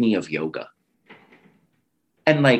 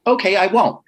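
high-pitched, quick-to-agree reply; acted compliance; 9 semitones higher, 4 dB louder than usual. Child answering mother's rule about conjuring spirits, acted compliance. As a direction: agree to mom's rule in a higher voice.

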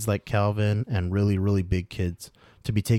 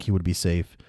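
plain reading voice

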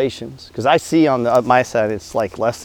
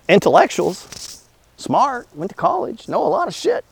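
sarcastic list, pitch climbing higher word by word; mocking; 8 semitones higher, 4 dB quieter, slower than usual. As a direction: sneer at the know-it-alls with a rising mock lilt. Mocking self-styled smart young debaters.

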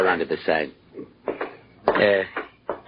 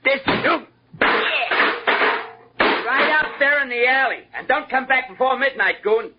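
shouting. Acted. Comic throws him out the door.

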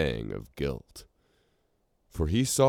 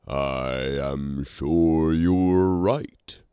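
slow, uncertain drawl; drawled uncertainly; 3 semitones higher, 5 dB louder, slower than usual. A narrator's reading. Romular, caught out by the king, drawling unsure. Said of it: "Romular drawled uncertainly".

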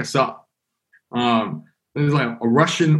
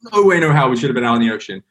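ordinary delivery

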